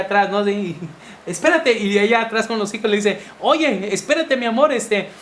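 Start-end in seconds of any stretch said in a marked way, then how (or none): 1.3-1.8 high, sharp impatient call of a husband. Retold or imitated. Husband up front snapping at his wife to hurry. 3.4-5.1 higher pitched flustered mother calling ahead; flustered wife. Retold or imitated. Wife behind with the kids, calling to her husband, flustered.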